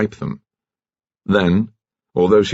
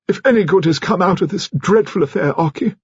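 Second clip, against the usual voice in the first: higher-voiced, measured dowager speaking gravely; an elderly aristocrat laying down the family standards; stately old lady voice, raised pitch; grand old lady; 12 semitones higher than usual. A narrator's reading. She addresses Mister Finn.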